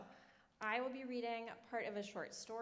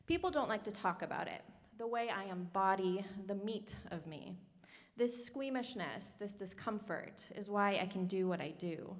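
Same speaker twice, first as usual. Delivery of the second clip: fuller, louder performance reading voice; story reading; 8 dB louder than usual. Acted. Prose writer reads her story aloud to the audience, performed. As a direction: read the story to hold the room.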